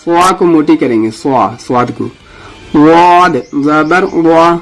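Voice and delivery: slow, deliberate letter-by-letter spelling; slow recitation drill. Shown as a demonstration. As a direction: spell each letter for students to repeat. Teacher leads letter recitation drill. (آہستہ قاعدہ پڑھانا)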